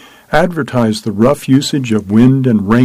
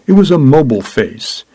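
ordinary delivery, nothing marked

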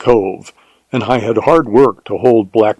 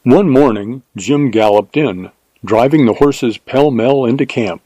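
ordinary delivery, nothing marked

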